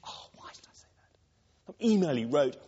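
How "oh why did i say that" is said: whispered to yourself in regret; regretful whisper. Retold or imitated. Listener recalling something said, whispering in regret. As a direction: breathe it out like a private self-reproach.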